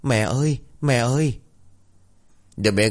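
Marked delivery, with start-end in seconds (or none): 0.0-1.4 higher, lightly voiced child on the verge of tears; child whimpering. A narrator's reading. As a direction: call for mum with a small whimper. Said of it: "mếu máo".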